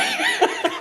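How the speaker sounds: a squealing laugh breaks out; real high laugh at himself. Real, not acted. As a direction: burst of high-pitched laughter. Laughing at own crying story.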